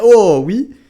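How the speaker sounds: high, loud cheer; excited shout. Real, not acted. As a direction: cheer the big hit. Caster reacts to a hit.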